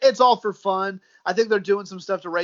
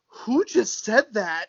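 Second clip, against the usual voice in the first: slow mock question; 4 semitones higher, much slower than usual. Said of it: "you hear somebody go".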